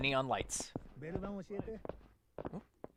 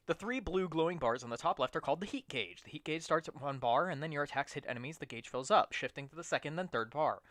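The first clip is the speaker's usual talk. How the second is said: tutorial read. Acted. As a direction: read the tutorial text flatly. Reads game tutorial text.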